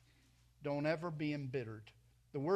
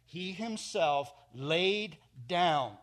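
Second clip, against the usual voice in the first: very slow, louder, "laid down" stretched; slow solemn verse; 5 dB louder, much slower than usual. Shown as a demonstration. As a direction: let "He himself laid down," sink in, unhurried. Verse read slowly with weight.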